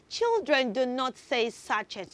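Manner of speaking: usual speaking voice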